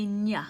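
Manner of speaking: ñ with the tongue pressed flat, ny glide; Spanish ñ; ñ sound. Shown as a demonstration. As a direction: say ñ as Spanish enye, ny like canyon. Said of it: "make a ñ sound".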